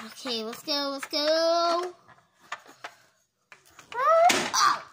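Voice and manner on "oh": sudden high yelp; startled cry. Pretend. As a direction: jump with surprise.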